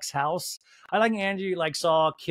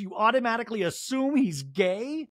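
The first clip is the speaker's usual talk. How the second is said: ordinary talk, nothing marked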